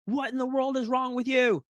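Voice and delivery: high, exasperated scolding dad; angry dad. Retold or imitated. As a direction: scold like a fed-up father. Dad voiced scolding child.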